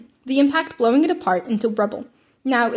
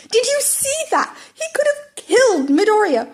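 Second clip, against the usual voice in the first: high, louder, shocked alarm; panicked classmate; 11 semitones higher, 4 dB louder than usual. Acted. A classmate panics watching Bakugo nearly kill Midoriya. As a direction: gasp it out in disbelief.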